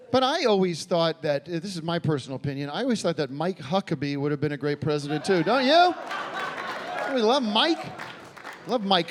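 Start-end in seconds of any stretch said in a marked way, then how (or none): 5.4-9.1 gushing. Acted. Comedian gushing to the audience about Mike, mock fan. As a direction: gush over Mike to the crowd.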